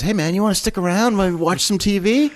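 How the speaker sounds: high, lazy stoned-guy voice; stoner voice. Retold or imitated. Stoned Floyd offers to hang out.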